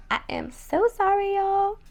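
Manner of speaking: apologetic. Real, not acted. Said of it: "why my voice is so raspy".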